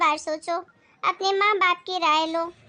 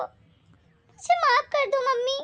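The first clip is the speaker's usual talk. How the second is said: slow, higher, tearful apology; tearful plea; 7 semitones higher, slower than usual. Acted. Daughter begs her mother once more, tearful.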